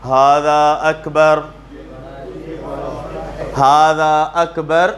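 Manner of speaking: slow recitation. Shown as a demonstration. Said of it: "ان جملوں کا آپ ترجمہ کریں گے".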